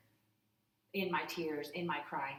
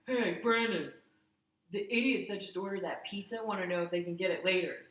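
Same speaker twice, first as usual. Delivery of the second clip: loud, pitched-up employee yelling to his manager; rude pizza guy; 3 semitones higher, 7 dB louder than usual. Retold or imitated. Pizza guy calling to his manager about customers, rude and loud. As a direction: put on an obnoxious, loud worker's voice. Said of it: "it's quite an impression of this fella".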